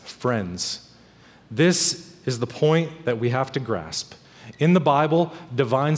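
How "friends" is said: much softer and lower, almost hushed; soft tender repeat. Real, not acted. Pastor tenderly repeating friends to his congregation, hushed. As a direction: lean in and say it gently.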